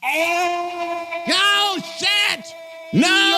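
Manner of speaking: high, wailing shout of dismay; long, high-pitched howl of frustration; dismayed cry. Real, not acted. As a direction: cry out high as it all goes wrong. Reacting to the wrong voice.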